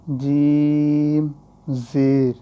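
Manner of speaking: جیم held long and loud; drawn-out letter name. Shown as a demonstration. Teacher spells for students, long drawn جیم. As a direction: stretch جیم out fully, as when spelling. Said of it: "تو ہجے کر لیں".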